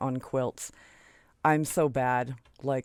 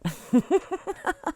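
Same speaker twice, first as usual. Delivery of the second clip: high-pitched excited giggle; gleeful laugh; 12 semitones higher than usual. Real, not acted. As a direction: giggle with delight at the big reveal. Giggles at revealing the quilt.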